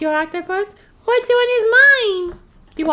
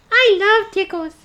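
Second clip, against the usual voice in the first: ordinary delivery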